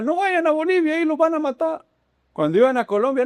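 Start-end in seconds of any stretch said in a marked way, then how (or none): 0.0-1.9 high-pitched mock panic; alarmed warning. Locals warning travellers off Bolivia, mock alarm. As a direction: imitate frantic locals, voice pitched way up.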